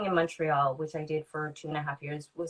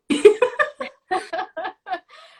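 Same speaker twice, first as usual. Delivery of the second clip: loud, high laugh; real, sudden high laugh; burst of laughter; 14 semitones higher, 11 dB louder than usual. Real, not acted. Laughs at own angsty remark.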